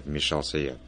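ordinary delivery

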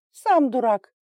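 high, loud snap back; sharp retort. A narrator's reading. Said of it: "резко ответила Инга". (резко, высоко и громко)

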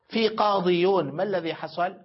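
usual speaking voice

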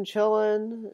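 normal talking voice

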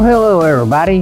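normal talking voice

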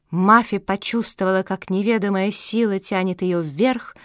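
plain reading voice

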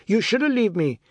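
put-on high, loud child's scream. Boy screams at his father who regrets his words, furious. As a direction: fling the words at him in hurt fury. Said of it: "Anand screamed".

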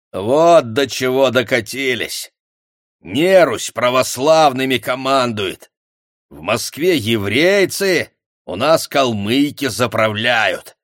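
loud, slow, bitter accusation; accusing tirade. A narrator's reading. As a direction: rant at them with contempt. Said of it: "продолжал обвинительную речь Коваль".

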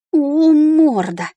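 loud, long drawn-out grumble; grumbling. A narrator's reading. Heroine scolding the dragon over her torn shirt, grumbling. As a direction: draw it out high in grumbling reproach. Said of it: "проворчала я".

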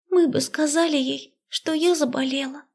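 put-on high girl's mumble, face pressed in chest; muffled mumble into chest. A narrator's reading. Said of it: "пробормотала Люся, уткнувшись мне в грудь". (приглушённое бормотание в грудь)

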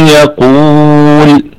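يقول drawn out very slowly with tajweed; slow drawn-out recitation. Shown as a demonstration. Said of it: "هكذا". (يقول ممدودة ببطء شديد كالتلاوة)

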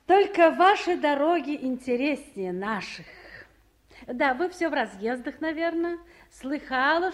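ordinary talk, nothing marked

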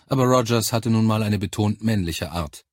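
ordinary delivery, nothing marked

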